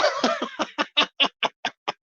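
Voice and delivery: high cackle at own joke; real high-pitched laughter; laughing. Real, not acted. Laughs at own Bristol impression.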